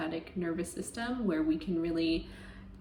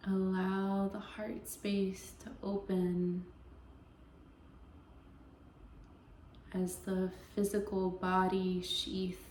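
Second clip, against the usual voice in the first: slow, even meditation voice with long pauses; guided meditation; 6 dB quieter, slower than usual. Acted. Yoga teacher easing listeners into Yoga Nidra, guided meditation voice. Leads a guided relaxation practice.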